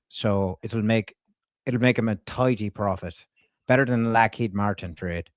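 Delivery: put-on Irish twang; Irish accent. Prank caller leaving a message with a staffer, in an Irish accent. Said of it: "she really liked my Irish accent".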